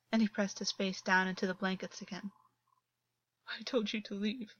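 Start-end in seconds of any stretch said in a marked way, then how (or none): 3.5-4.5 softer, fragile push-away; quiet broken dismissal. A narrator's reading. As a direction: try to be firm but crumble. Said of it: "his voice was still fragile".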